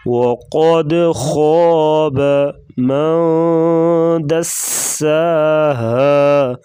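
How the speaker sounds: full heavy خ in خواب, echoing قد; slow, thick recitation; tajweed recitation. Shown as a demonstration. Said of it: "قلقلہ کریں گے اور خا کو پر پڑھیں گے".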